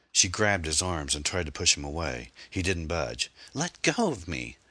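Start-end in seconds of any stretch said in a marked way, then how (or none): 3.5-4.6 slow, high-pitched demand to be released; distressed demand. A narrator's reading. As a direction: draw it out high, without shouting. Woman trying to push attacker away.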